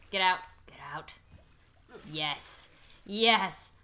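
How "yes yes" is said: ordinary talk, nothing marked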